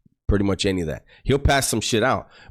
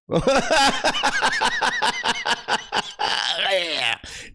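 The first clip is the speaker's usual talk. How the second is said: long breathless giggle; real giggle fit; high wheezing laugh. Real, not acted. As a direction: crack up. Laughing at the booger memory.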